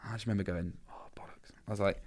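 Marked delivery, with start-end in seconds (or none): 0.9-1.5 under his breath, almost voiceless whisper; whispered swear of sinking dread; hushed dismay. Retold or imitated. To himself on hearing the band is in, hushed dismay.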